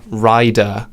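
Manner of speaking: ordinary talk, nothing marked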